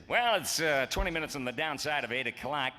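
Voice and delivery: pompous radio announcer. Retold or imitated. Mocking old radio announcers, hammed-up pompous announcer voice. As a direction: play a big dumb radio voice loving itself. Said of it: "dumb announcers".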